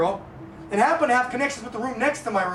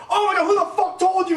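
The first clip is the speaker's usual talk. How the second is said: high, raised retold outburst; mad; 8 semitones higher, 4 dB louder than usual. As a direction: demand it in agitated disbelief. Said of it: "I was all mad".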